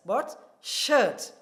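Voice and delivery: shirt leaned on, loud; stress on shirt. Shown as a demonstration. Teacher contrasting with trousers, stress on shirt. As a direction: punch the word shirt. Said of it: "we are now stressing shirt".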